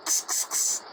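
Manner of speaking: hissed kss kss to urge on a dog; whispered dog-urging hiss; sic'em hiss. Retold or imitated. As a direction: hiss to set a dog loose. Setting the dog on him.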